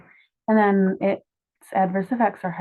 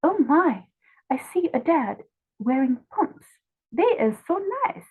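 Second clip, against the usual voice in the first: high, lightly hammed French lady accent; 8 semitones higher than usual. French lady admiring a dad in pumps, mock French accent. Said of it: "I'm not very good at imitating a French accent".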